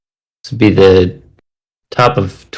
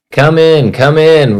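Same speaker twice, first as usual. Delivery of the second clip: higher-pitched, lightly acted roar; mild roar; 7 semitones higher, slower than usual. A narrator's reading. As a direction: call them in with a restrained storybook roar. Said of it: "roared Jack".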